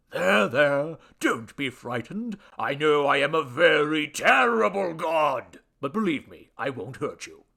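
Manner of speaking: loud, theatrical comforting that settles at the end; self-important deity soothing someone frightened; pompous little god reassuring. Acted. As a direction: reassure grandly, then ease down.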